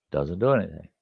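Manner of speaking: usual speaking voice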